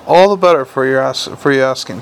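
ordinary delivery, nothing marked

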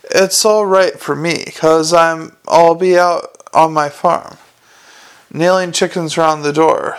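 ordinary delivery, nothing marked

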